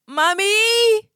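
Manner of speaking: put-on high, tearful little boy calling Mommy; imitating her crying son; crying child. Mother retelling her son's tearful call.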